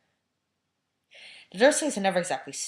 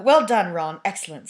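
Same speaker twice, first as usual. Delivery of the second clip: pompous; 2 semitones higher, 11 dB louder than usual. A narrator's reading. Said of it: "said Percy Weasley pompously".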